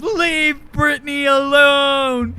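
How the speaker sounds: loud, slow, tearful wail; loud drawn-out meme wail. Retold or imitated. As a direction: howl it like the viral crying video. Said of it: "to the tune of".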